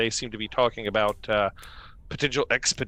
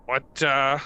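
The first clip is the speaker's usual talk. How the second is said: town guard greeting; 5 semitones higher than usual. Acted. Guard NPC greets the party.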